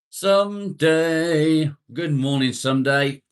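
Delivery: high, loud, drawn-out hail; calling out greeting. Real, not acted. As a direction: stretch the name out as you call it. Hailing a viewer joining the stream.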